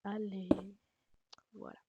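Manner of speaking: normal talking voice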